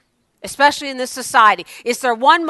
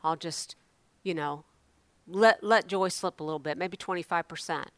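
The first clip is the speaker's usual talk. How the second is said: quiet mock excuse; 4 semitones lower, 11 dB quieter than usual. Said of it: "Do you guys think".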